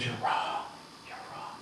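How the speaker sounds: fading soft whisper; walking away, fading whisper. Retold or imitated. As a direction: whisper it as you walk away.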